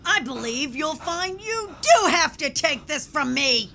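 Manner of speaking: ordinary talk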